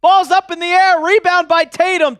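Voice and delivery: excited courtside commentator call; live play-by-play. Acted. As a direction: call the action like a broadcaster. Calls the game live.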